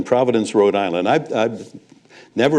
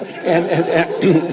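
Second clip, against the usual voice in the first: laughing; 8 semitones higher, much slower than usual. Real, not acted. Laughing about the story.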